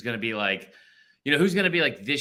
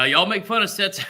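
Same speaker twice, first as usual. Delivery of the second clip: lightly put-on Southern accent, higher and louder; 7 semitones higher, 6 dB louder than usual. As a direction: imitate a friend with a hint of Southern twang. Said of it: "I'm giving a Southern accent".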